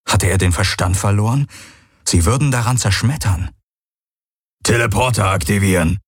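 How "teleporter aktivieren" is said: a lightly different voice, commanding; Hox calling out. A narrator's reading. Hox calls commands to the teleporter, lightly voiced. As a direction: command the machine. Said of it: "rief der Aur".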